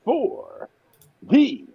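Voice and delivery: booming circus announcer; ringmaster. Acted. Announces the giveaway segment.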